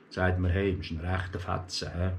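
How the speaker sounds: ordinary delivery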